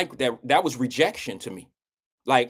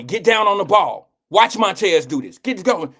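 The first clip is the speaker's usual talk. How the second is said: louder, higher barked commands; coach shouting orders; 5 semitones higher, 5 dB louder than usual. Retold or imitated. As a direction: shout it across the field like a coach. Coach voiced in story.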